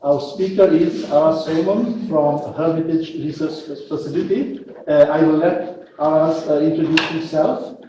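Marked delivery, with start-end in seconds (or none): none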